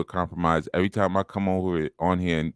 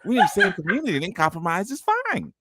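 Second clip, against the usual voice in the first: high, teasing mimicry of the co-hosts; mock them in a silly high voice; mocking imitation; faster than usual. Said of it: "'cause I could rewind the tape".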